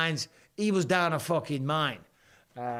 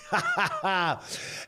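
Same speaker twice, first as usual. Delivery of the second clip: high, open burst of laughter; hearty laugh; 6 semitones higher than usual. Real, not acted. Streamer amused by Bud Light drinks offer, laughing.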